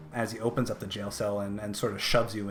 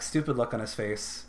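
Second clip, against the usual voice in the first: usual speaking voice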